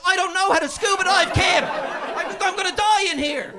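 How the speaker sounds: high, loud panicked shout. Retold or imitated. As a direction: beg in mock panic. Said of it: "And I'm like".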